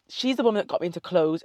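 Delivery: usual speaking voice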